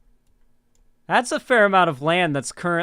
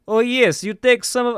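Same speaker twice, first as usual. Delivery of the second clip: put-on abandoned try at a Ukrainian accent, pitched higher and louder; failed Ukrainian accent; 8 semitones higher, 5 dB louder than usual. Streamer giving up on a Ukrainian voice, half-hearted Ukrainian accent. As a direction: start a Ukrainian accent, then give up on it. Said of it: "I can't do a Ukrainian accent".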